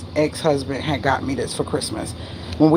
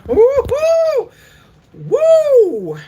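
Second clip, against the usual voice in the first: long, high-pitched exasperated whoops; loud, piercing venting "woo"; 7 dB louder, much slower than usual. Real, not acted. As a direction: let the anger out in drawn-out high woos. Venting anger at being misled.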